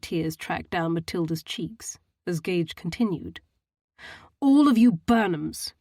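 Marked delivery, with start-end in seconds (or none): 4.4-5.7 disgusted. A narrator's reading. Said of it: "he stated in disgust".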